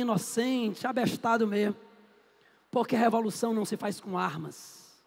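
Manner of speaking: normal talking voice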